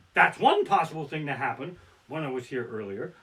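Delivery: ordinary talk, nothing marked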